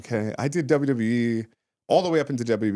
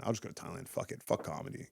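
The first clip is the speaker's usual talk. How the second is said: fading to a near-whisper, giving up; defeated whisper; 6 semitones lower, 12 dB quieter than usual. Retold or imitated. Comic voicing his low moment about quitting comedy, soft defeated whisper. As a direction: breathe it out like quitting. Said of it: "when you're feeling down".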